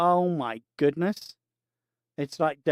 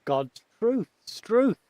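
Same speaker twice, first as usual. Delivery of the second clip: put-on Aussie accent; 6 semitones higher, much slower than usual. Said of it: "that's where the Aussies get it from".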